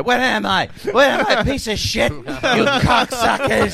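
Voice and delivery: high, loud comic meltdown, last word stretched out; imitating a raging, cracking performer; onstage breakdown. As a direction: yell it like a comic losing it onstage. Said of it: "he'd have a breakdown every week".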